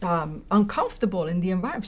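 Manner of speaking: ordinary delivery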